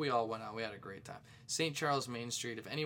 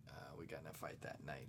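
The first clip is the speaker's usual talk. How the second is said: quiet aside; 7 semitones lower, 12 dB quieter than usual. Real, not acted. Restates fight as quiet aside.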